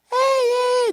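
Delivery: shrill high-pitched falsetto call; high girly voice. Retold or imitated. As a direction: squeak it out like a girl. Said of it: "they talk like this".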